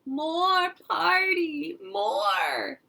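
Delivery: put-on very slow, high, loud toddler wail; a little boy who won't leave; whining toddler. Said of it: "He cried".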